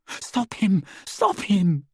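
high, urgent plea; crying out as Hermione. A narrator's reading. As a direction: cry out in alarm for help. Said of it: "Hermione cried".

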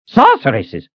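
mild yelp. Acted. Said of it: "Reuben yelped".

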